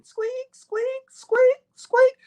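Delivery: high squeaky floorboard noise; mimicking the floor as the cop approaches; squeaking floor. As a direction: imitate a creaking floor. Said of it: "I hear the floor squeaking".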